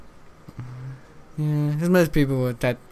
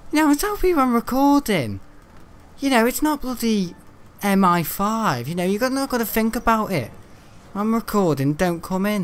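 exasperated rant; 7 semitones higher than usual. Real, not acted. Ranting about mum entering room.

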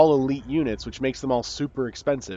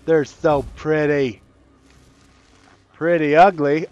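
excited; 8 dB louder, slower than usual. Real, not acted. Admiring units.

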